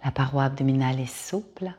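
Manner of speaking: calm Yoga Nidra guide with long pauses; guided meditation. Acted. Yoga teacher guiding listeners to lie down and release, guided meditation. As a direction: lead them into rest, phrase by unhurried phrase.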